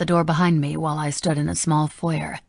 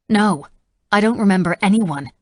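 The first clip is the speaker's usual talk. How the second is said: short, curt, a bit louder and higher; clipped; 3 semitones higher, 6 dB louder than usual. A narrator's reading. Ramey snapping back at the senator, clipped. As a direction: bite off the words. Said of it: "I clipped".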